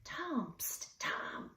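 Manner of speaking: urgent unvoiced hiss; hissed whisper. A narrator's reading. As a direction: hiss his name so the cat won't hear. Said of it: "Mister Little hissed".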